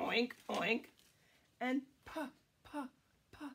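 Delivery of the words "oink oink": put-on oinking along with children; pig oink. Shown as a demonstration. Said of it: "Can you say that?".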